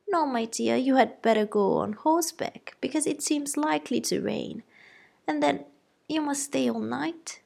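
high-pitched fretting mother; flustered wife defending her girls; fussy mother. A narrator's reading. Mrs Bennet scheming.

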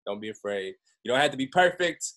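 normal talking voice

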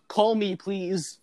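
slow, high, drawn-out plea; hammed-up end of a lawyer ad; mock ad sign-off. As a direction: stretch it out as a pleading pitch. Acting the ad's closing plea.

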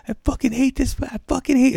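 high-pitched mock tantrum; whine-yell like an enraged kid; acted-out childish outburst; imitated yelling. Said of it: "He's fucking yelling at me".

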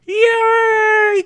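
high, loud cheerful yay; Kermit the Frog. Shown as a demonstration. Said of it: "do your best Kermit the Frog and go".

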